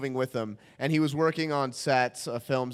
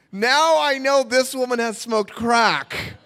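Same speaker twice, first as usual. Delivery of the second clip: ordinary talk, nothing marked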